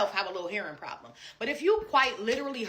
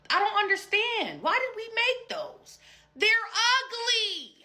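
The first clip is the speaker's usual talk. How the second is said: much higher, drawn-out disbelief; exasperated disgust; 14 semitones higher, slower than usual. Real, not acted. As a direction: squeal out how ugly they are. Ranting about collared shirts.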